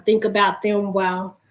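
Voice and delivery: ordinary talk, nothing marked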